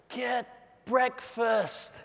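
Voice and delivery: ordinary delivery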